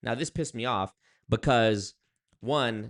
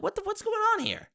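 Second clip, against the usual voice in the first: much higher, stunned squeak; shocked outburst. Retold or imitated. Vlogger retelling his shock that dates pay, high squeal. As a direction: react like you can't believe it. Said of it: "I'm like".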